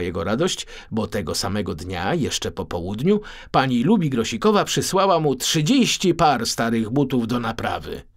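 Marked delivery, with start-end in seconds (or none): none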